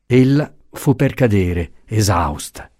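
usual speaking voice

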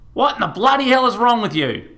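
high, loud outraged shriek at her son; mother's high furious outburst; furious mum. A narrator's reading. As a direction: blow up at him in disbelief.

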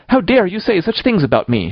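high-pitched, vehement indignation; passionate, high angry challenge. A narrator's reading. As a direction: demand it in childish outrage. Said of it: "she repeated vehemently".

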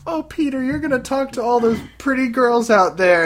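high-pitched, loud mock girlfriend panic; hammed-up jealous girlfriend whining at her boyfriend; Betty Brant freaking out. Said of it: "starts freaking out 'cause she's like".

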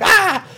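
short, high, loud "ah!"; sudden yell. Acted. Player charging up at bugs, high yell. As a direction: let out a sharp, high yelp. Startled bug player running up.

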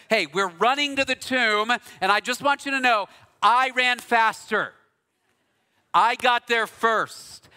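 a hint of a smug disciple bragging; mock boastful John. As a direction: brag like a kid who won the race. John boasting he ran faster.

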